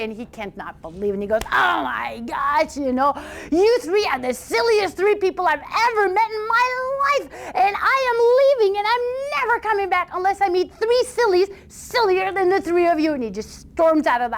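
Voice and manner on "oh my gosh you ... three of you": fed up and walking out on the family; exasperated sweetheart. Acted. Sweetheart scolds the sillies.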